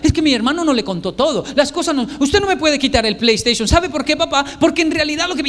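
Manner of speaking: hammed-up boy arguing back; arguing child. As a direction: plead your case like an indignant kid. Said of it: "Y comienza a hablar".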